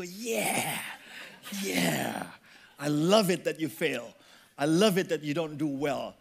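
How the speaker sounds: secret gloating inner voice. Retold or imitated. Believer gloats silently over a friend's failure, low and smug. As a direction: purr it like a secret delight. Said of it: "But inside you go".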